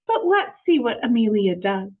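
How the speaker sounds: plain reading voice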